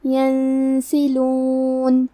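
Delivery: ینسلون very slowly, the noon hidden in a long nasal hum before س. Shown as a demonstration. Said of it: "نون ساکن کو چھپاتے ہوئے اور غنہ کے ساتھ". (نون ساکن کا اخفاء غنہ کے ساتھ)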